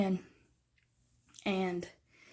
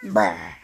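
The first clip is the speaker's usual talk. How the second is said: playful bah; 4 dB louder than usual. Real, not acted. Playful noise at the cat.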